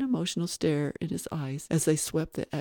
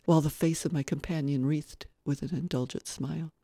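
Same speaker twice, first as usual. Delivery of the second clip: plain reading voice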